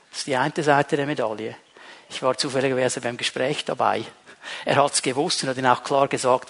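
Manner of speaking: usual speaking voice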